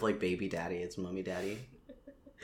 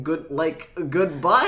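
Shaggy voice; 4 semitones higher, 7 dB louder, slower than usual. Retold or imitated. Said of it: "And Shaggy's like".

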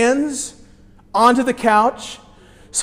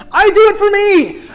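emphatic repeat; 11 semitones higher, 6 dB louder than usual. Acted. Preacher repeats line emphatically.

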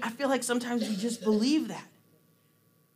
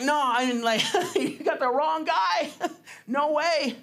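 giggling, pitched up, no way; laughing protest; 5 semitones higher than usual. Retold or imitated. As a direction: laugh while refusing the praise. Gideon protests again, laughing.

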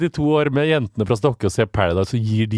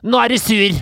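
imitating boss; 10 semitones higher, 4 dB louder than usual. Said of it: "Så hun sa".

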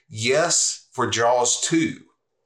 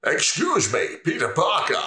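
higher Sean Connery-style lizard villain; 8 semitones higher than usual. Acted. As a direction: purr the line like a smug reptile villain. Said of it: "it's somewhat like Sean Connery".

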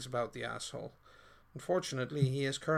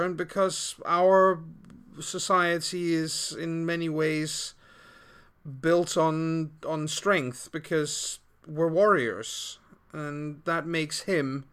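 slower, louder in-character voice; character speech; 3 semitones higher, 7 dB louder than usual. Acted. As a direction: speak as the proud warrior. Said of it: "And she says".

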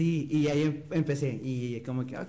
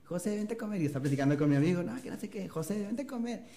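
imitating mom calling; 6 semitones higher than usual. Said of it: "pone a la José".

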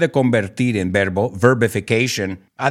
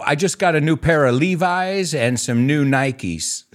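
unhurried demonstration in American English; slow, easy American accent; American English example; slower than usual. Shown as a demonstration. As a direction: model the sentence in natural American English. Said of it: "¿cómo sería en una frase?".

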